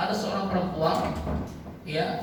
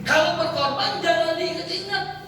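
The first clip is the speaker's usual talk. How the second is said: higher-pitched, impassioned sermon delivery; fervent preaching; 6 semitones higher than usual. Acted. Preacher urging couples to serve with love, fervent. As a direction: preach it with rising urgency.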